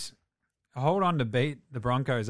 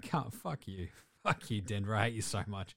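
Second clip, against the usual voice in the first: softer, muttered mock curse at Denver; a half-laughing, hushed grumble at the rival team; mock bitter curse; 4 semitones lower, 7 dB quieter than usual. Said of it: "You're like".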